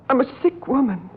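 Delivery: ordinary talk, nothing marked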